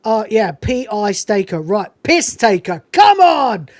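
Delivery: mock disbelief that snaps into a shout; exasperated outburst. Sergeant on the phone losing patience, building to a loud come on. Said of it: "He goes".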